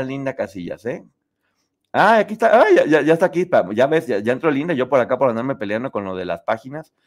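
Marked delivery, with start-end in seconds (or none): none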